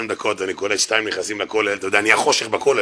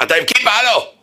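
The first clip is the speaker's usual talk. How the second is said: mock taunt; 10 semitones higher, 4 dB louder than usual. Mocking a driver's taunt.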